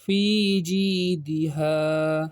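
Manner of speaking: very slow, drawn-out Quranic reading; teacher reciting slowly for the class; slow fluent recitation. Shown as a demonstration. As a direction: stretch each syllable in recitation. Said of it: "اب اس کو رواں کیسے پڑھیں گے".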